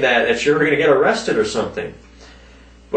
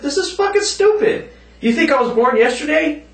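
much higher, hammed-up indignant yell; mock outrage, pitched way up; retold angry outburst; 14 semitones higher than usual. Retelling telling off the scammer, hammed-up outraged shout. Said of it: "I said".

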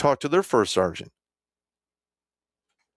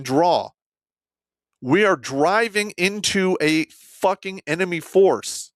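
higher-pitched, emphatic retold objection; heated protest; 6 semitones higher than usual. Soldier disputing the escort mission with his commander, heated protest. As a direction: argue it insistently, slowing on the last line.

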